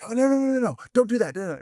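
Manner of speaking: high, alarmed no-no-no; mock panic at a kid's news; fear-based panic. Parent mock-panicking at a kid's shocking news. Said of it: "fear-based".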